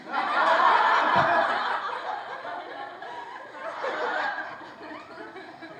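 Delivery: long breathy burst of laughter. Real, not acted. Speaker laughing while recalling tickling someone. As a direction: laugh out hard at the memory.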